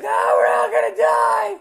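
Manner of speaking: high, drawn-out scream of doom; hammed-up terrified yell, very high and loud; panicked scream. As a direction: scream it slowly like you're facing death. Mock terror as the snow hits.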